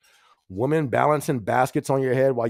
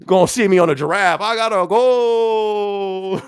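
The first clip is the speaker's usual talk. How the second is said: playful shout; 6 semitones higher, 8 dB louder than usual. Pretend. Streamer jokes about running off to Africa, playful shout. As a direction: holler it like a gleeful getaway, stretching the end.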